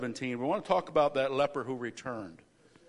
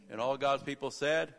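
ordinary talk

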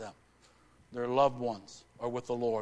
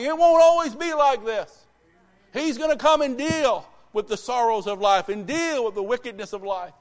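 raised pitch, building to loud proclamation; rousing preaching; 8 semitones higher, 8 dB louder than usual. Real, not acted. Preacher urges struggling believers to hang on, rousing exhortation. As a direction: preach it with mounting force.

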